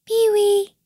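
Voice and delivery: high, loud kiwi bird call. A narrator's reading. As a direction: call to faraway mates in a high voice. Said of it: "called out to his mates".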